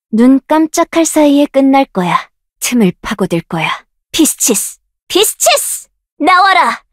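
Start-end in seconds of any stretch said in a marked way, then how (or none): 5.1-6.8 much higher pitch, rising at the end; high shouted spell call. Acted. Caster shouting the spell high with a rising end. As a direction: fling the name up high. Spell name called in battle.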